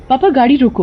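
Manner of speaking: put-on high girlish call; a daughter urgently stopping the car; young girl. Daughter telling her father to stop the car, high.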